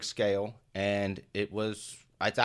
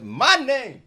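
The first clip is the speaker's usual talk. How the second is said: hyped intro; 10 dB louder than usual. Acted.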